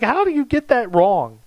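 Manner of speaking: high-pitched incredulous retort; hammed-up bafflement, voice pitched right up; mock disbelief. Pallbearer reacting to the misprinted name, playfully incredulous.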